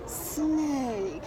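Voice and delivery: hushed snake hiss. Pretend. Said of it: "let's go like this".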